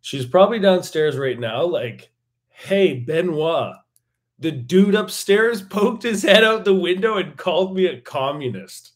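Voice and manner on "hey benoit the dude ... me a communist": higher, louder voice of an outraged neighbour; indignant neighbour. Acted. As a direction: call out to Benoit in mock disbelief. Voicing the neighbour downstairs calling out.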